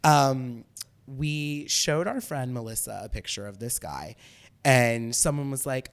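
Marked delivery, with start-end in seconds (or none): none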